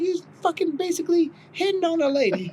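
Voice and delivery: high mock wife accusing him; imitating wife. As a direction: pitch up and accuse like the jealous wife. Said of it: "She was like".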